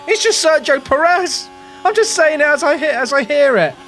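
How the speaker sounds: high-pitched, exasperated self-defence; exasperated retort. Retold or imitated. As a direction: protest it as if it were obvious.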